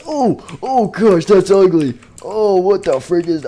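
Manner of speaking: high, loud hammed-up shock; horrified disgust. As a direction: recoil from an ugly sight. Reacts to ugly unmasked face.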